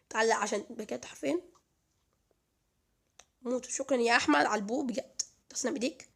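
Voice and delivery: ordinary delivery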